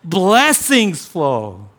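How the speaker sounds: loud, drawn-out hymn recitation; loud praise. Acted. Healed man praising God, loud and drawn out. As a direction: proclaim it slowly and loudly in worship. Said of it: "loudly started worshiping God".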